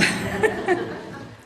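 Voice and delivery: a breathy, nervous little laugh, unvoiced. Real, not acted. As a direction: laugh off the nerves. Nervous laugh mid-confession.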